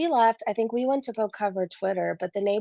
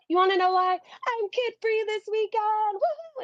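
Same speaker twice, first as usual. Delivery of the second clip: excited glee; 11 semitones higher than usual. Real, not acted. Telling her stream she's kid-free, giddy squeal. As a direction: gush it out, thrilled to be free.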